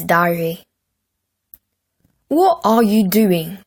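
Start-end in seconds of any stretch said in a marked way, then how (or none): 2.3-3.6 slow demanding Henry voice; boy's demanding voice. A narrator's reading. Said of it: "demanded Horrid Henry".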